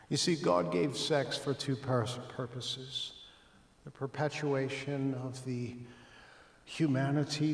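ordinary talk

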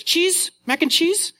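put-on very high, slow, sing-song little girl; drawn-out excited toddler chant. Dad imitating his daughter over mac and cheese, high and slow. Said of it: "she goes".

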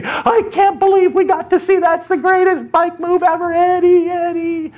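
very high, gushing fanboy squeal; ecstatic cheering. Acted. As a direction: gush wildly, pitched sky-high. Boys cheering and hugging after jump.

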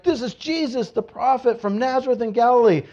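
high-pitched eager answer; excited announcement. Acted. Crowd telling the city about Jesus, excited announcement. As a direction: proclaim the news to a stirred-up crowd.

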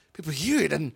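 ordinary talk

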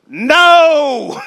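loud, high, shouted No; John's shout. Acted. John answering people who trust their works, a loud high shout. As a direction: bark a flat refusal.

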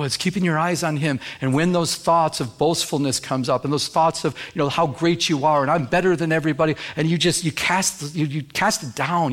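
ordinary talk, nothing marked

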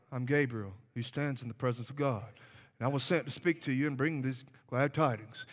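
low, quick, droning recital of Gabriel's words; flat dull reading. Shown as a demonstration. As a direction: read it low and lifeless, like a bored recital. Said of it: "you can't read it like".

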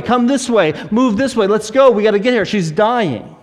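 quick string of urgent mock commands; urgent pushing through. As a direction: hurry people along, acting out a desperate father. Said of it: "trying to push people out of the way".

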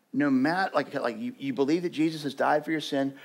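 ordinary talk

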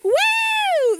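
high, loud whoop; kid's cheer. Retold or imitated. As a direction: whoop like a thrilled kid. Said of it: "They're like".

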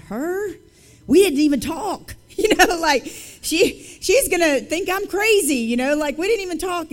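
high-pitched, flustered disbelief; incredulous protest. Retold or imitated. Woman battling God over talking to a stranger, incredulous. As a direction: argue back, voice jumping up in disbelief. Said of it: "I'm battling God".

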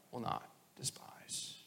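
a reverent whispered ending. Real, not acted. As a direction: let the psalm fade to a whisper. Ending psalm quote.

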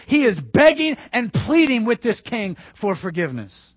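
usual speaking voice

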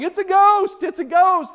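high, loud panicked shriek; mock-terrified disciples screaming. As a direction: squeal like a sailor who's seen a ghost. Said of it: "they're screaming and yelling".

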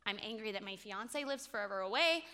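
ordinary delivery

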